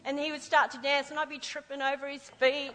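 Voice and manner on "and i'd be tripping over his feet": usual speaking voice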